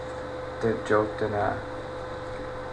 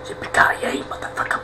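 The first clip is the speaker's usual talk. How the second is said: breathy, voiceless movie line; whispered catchphrase; 6 dB quieter than usual. Retold or imitated. Gamer quotes the action-hero line to himself, whispering. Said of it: "Jeg er bare sådan".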